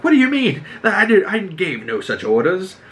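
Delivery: high-pitched mock indignation; pissed off. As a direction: play an affronted king protesting. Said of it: "Aegon's pissed. He's like".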